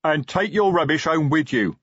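restrained troll bellow; troll roar. A narrator's reading. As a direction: roar it like a troll, held in check. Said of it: "Detritus roared".

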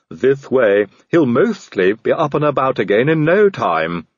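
mildly bright servant's voice. A narrator's reading. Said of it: "he added brightly".

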